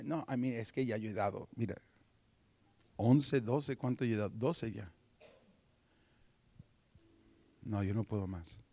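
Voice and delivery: low, soft self-justifying mumble; reluctant excuse. Retold or imitated. Giver telling God he has given enough, reluctant. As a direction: count it up quietly and beg off.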